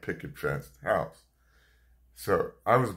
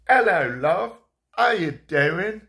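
put-on high, loud, slow London accent; chirpy London granddad, drawn out in a London accent; grandfather's British greeting; 11 semitones higher, 8 dB louder, slower than usual. Grandfather greeting mother at the door, high London accent. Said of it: "he would greet my mother and go".

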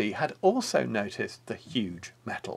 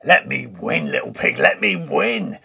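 wolf shouting, louder; shouted; 7 dB louder than usual. Acted. Wolf shouting at the pig mid-race, loud. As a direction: call out to the pig to let you win. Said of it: "he shouted".

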